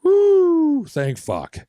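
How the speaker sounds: high relieved whoop then a mutter; mock relieved cheer. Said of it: "kinda do the whole".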